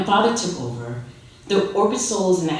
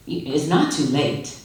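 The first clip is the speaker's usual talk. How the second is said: ordinary delivery, nothing marked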